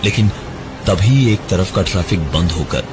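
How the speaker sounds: usual speaking voice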